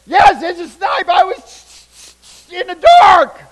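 loud, high protest, drawn out at the end; insistent shouting. Retold or imitated. Boy insisting to commander it was a snipe, shouting. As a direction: argue back at a yell like an indignant kid.

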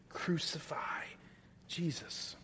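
whispered, soft and hushed; chilling whisper. Acted. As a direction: breathe it out like a horrified secret. Quiet echo of crowd's cry.

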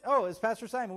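ordinary talk, nothing marked

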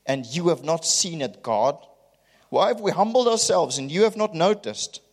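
high, mocking, aggrieved questions; preacher mocking the people's complaint to God; sarcastic whine. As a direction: send up their self-pitying complaint. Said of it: "sarcasm in the Hebrew".

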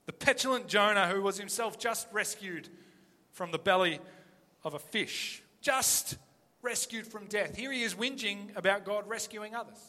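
ordinary delivery

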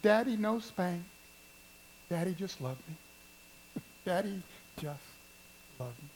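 small, soft imitation of a little child; lightly voiced little girl, hushed and halting; four-year-old voice. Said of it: "in that little four-year-old voice".

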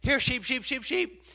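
high, loud call to the flock; calling sheep. Retold or imitated.

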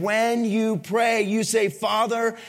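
ordinary talk, nothing marked

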